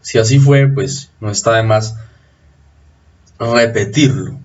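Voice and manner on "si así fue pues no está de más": ordinary talk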